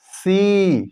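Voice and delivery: सी with the ee held long. Shown as a demonstration. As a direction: stretch the ee in सी. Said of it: "ई का उच्चारण को लंबा खींचा". (सी की ई लंबी)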